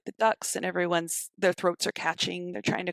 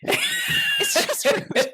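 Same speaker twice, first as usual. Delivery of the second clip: much higher, very slow, breathy squeal of outrage; mock outraged shriek; 12 semitones higher, 4 dB louder, much slower than usual. Real, not acted. Speaker hamming up outrage over the raw turkey, slow squeal. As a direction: draw it out as a hammed-up squeal.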